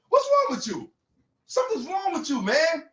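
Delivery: emotional. Real, not acted. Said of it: "Look how you getting all emotional".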